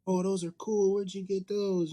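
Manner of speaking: put-on high, excited kid voice; imitating a kid. Son's friends admiring the homemade cards, high kid voice. Kids' reaction to fake cards.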